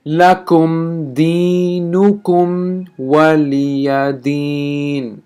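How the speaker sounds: very slow chanted recitation; teacher recites the final verse. Shown as a demonstration. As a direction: draw out the last verse. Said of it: "the last verse is".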